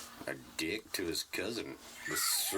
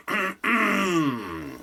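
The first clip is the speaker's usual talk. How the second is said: high, loud put-on comic voice; hammed-up, high-pitched protest; impaired-speech character; 9 semitones higher, 10 dB louder, much slower than usual. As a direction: belt the catchphrase in a high character voice. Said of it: "the only line I had was".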